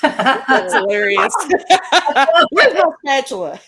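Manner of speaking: loud and high, laughing through it. Real, not acted. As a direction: crack up while talking. Cracks up at spatula joke.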